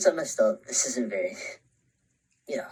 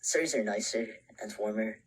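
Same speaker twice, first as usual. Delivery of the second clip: usual speaking voice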